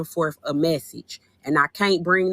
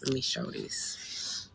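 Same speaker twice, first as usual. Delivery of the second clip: soft, slow whispered aside; 3 semitones lower, 15 dB quieter, slower than usual. Shown as a demonstration. Streamer whispering a line to her live audience. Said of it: "let me just show this".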